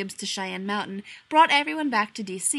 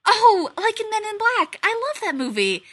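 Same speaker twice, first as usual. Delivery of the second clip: much higher, louder, delighted; gushing; 13 semitones higher, 9 dB louder than usual. A narrator's reading. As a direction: squeal over the movie. Abby gushes about the movie.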